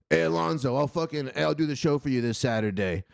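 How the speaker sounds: deep, steady impersonation; deep-voiced Jacob. Jacob offering to do the show, deep voice impression. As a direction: drop into a deep man's voice. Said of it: "do my deep voice Jacob impression".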